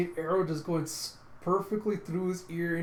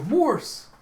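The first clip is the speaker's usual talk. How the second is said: high, loud cry of alarm; shocked shout; 11 semitones higher, 10 dB louder than usual. Retold or imitated. Companions seeing Morse dead, shocked shout. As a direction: cry out a fallen friend's name.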